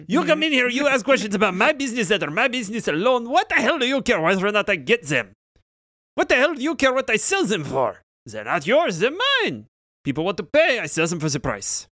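hammed-up fury of a small creature; angry shopkeeper rant. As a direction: scold them for prying. Myconid rants at the party.

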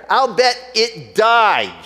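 slow, emphatic, mock-angry accusation; steaming mad. Angry customer confronts the pet store owner, mock angry. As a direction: lean into it like a fed-up customer. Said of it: "Now she's steaming mad".

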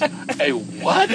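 high, loud, baffled voice for Raj; a bewildered "A what?" pitched high; puzzled Raj. Retold or imitated. Raj answering the strange biker, high puzzled voice. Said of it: "And Raj goes".

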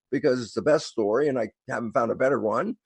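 normal talking voice

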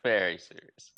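low, grave echo; mock very serious. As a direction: drop the pitch in mock gravity. Echoing the deep serious movie voice.

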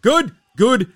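loud, pumped-up call; hyped up. Real, not acted. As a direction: fire up the audience. Hyping up the show start.

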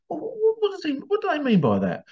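prim wife. Retold or imitated. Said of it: "you can imagine his wife".

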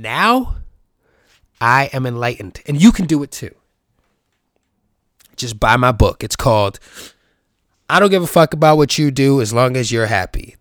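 loud, hammed-up guru sales pitch; loud mock-enlightened promo; mock self-help pitch. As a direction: sell the book like an infomercial.

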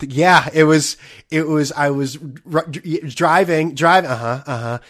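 loud, stammering contestant telling an anecdote; mock awkward contestant. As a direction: stumble eagerly over the words, much too loud. Imitates awkward contestant telling story.

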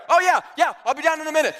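mock flustered excuse; hammed-up panicked reassurance; flustered hurry. Friend scrambles, remembering work.

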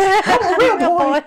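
put-on high, loud, slow puppet-boy voice; Pinocchio. Playing along after the Pinocchio remark, mock puppet voice. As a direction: call it out high, half laughing. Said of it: "You sounded like Pinocchio".

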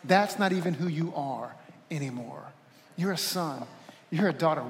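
soft, low and tender; Spirit's gentle reassurance. Acted. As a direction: speak it gently over someone who feels they failed. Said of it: "The Spirit of God would say to you".

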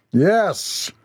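high, drawn-out confirmation; doomful declaration. A narrator's reading. Leader confirms the flood to his men, high and doomful. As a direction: stretch it into a pronouncement of doom. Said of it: "the doomful declaration".

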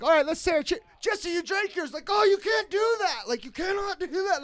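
high-pitched imitation of upset drinking buddies; mock upset. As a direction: protest in a high mock-outraged voice. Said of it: "they get upset with me".

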